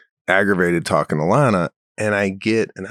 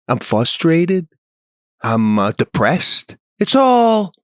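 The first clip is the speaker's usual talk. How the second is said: ranting; 3 semitones higher than usual. Retold or imitated. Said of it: "I went into a rant".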